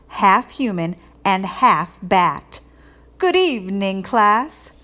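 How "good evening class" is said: a hint of a spooky Transylvanian accent, a bit louder; scary Transylvanian accent. Teacher in bat costume greeting the class, mild Transylvanian accent. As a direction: greet them with a lightly Transylvanian vampire lilt. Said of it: "in a scary Transylvanian accent".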